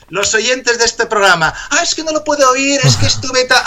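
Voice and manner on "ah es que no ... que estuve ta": higher, hurried mock excuses; put-on excuses, breaking into laughter; mock excuse-making listener. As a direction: imitate a listener rattling off excuses.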